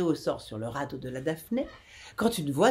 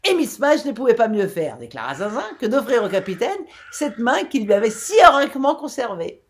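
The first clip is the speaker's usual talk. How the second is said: jeering laugh; 6 semitones higher, 7 dB louder than usual. A narrator's reading. Zinzin jeers with laughter.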